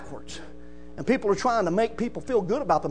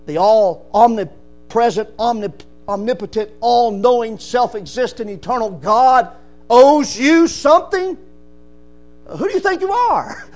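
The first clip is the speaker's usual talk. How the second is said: fiery preaching; 5 dB louder than usual. Acted. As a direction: rebuke the proud listener. Preaching at full force.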